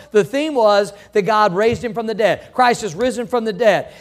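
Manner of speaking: normal talking voice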